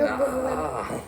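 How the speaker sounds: high, drawn-out mock wail; hammed-up "ah" of defeat; dismayed wail. Curser guessed a second time, dismayed wail.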